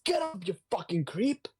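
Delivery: high-pitched acted angry outburst; mock angry. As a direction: play an irate attacker, voice pushed up. Acts out a villain's angry order.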